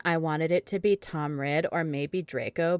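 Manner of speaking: plain reading voice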